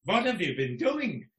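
put-on mild Swedish accent. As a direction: ask it like a Swedish friend would. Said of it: "I'll try and do a Swedish accent".

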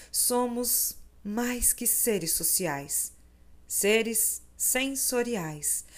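slow. Acted. Reflective essay reading.

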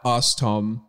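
ordinary talk, nothing marked

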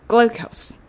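グルコース with a very short グル; English glucose. Shown as a demonstration. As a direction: glide over グル into コース. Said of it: "めっちゃ短く発音してください".

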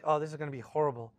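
quick, mumbled dread; rushed inner doubt. Retold or imitated. Preacher mimics his own dread before a sermon, quickly. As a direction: rattle off the worry fast. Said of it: "and I feel like".

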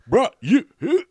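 high, loud jeering bruh; loud biker taunt. Retold or imitated. The biker still taunting the comics, loud. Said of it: "he keeps saying".

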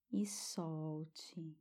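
soft whispered exhale cue; whispering. Shown as a demonstration. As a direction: breathe the words out. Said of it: "Respire bem fundo".

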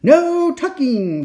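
high, loud, long-drawn 'no'; chorus a drawn-out pirate refusal; crew cry. A narrator's reading. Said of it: "the crew cried".

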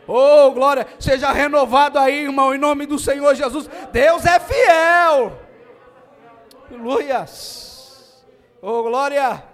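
loud fervent preaching; preaching at full force. Real, not acted. Preacher blessing the church, loud fervent shouting. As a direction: shout the glory over the music.

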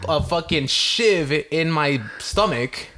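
slow, high, laboured sarcasm; incredulous protest. Retold or imitated. Patient arguing with clinic staff about consent, incredulous. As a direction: drag out each phrase in disbelief.

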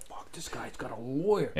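muttered under the breath, slow; hushed mock aside. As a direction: cops groaning quietly to each other about the lawyer, low and slow. Cops voiced in retold story.

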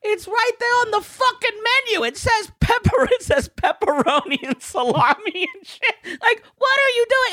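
very high-pitched, incredulous outburst; exasperated rant. Retold or imitated. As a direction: vent in comic, squeaky outrage. Silent rant at slow customer, acted out.

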